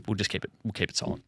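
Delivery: a secretive hushed aside; whispered aside. Real, not acted. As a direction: whisper it, voice dropped. Hushed aside about surnames.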